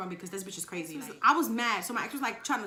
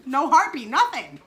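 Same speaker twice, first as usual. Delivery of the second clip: louder, indignant question; loud outraged; 2 semitones higher, 9 dB louder than usual. Retold or imitated. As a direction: throw it out in disbelief. Outraged retelling of the lie.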